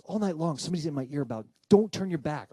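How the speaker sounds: agitated mother. Retold or imitated. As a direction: complain insistently about a sleepless night. Mom retells her frightening night.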